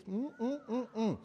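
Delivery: soft mock church lady hum. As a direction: tut with closed lips like a church lady. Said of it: "he's really not the church lady".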